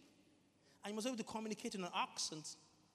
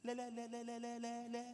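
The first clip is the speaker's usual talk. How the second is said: raised-pitch stutter on one syllable; stammering Moses; 4 semitones higher than usual. Acted. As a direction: stick on the syllable at a steady pitch, without shouting. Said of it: "Because he was stammering".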